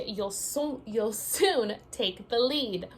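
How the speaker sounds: ordinary talk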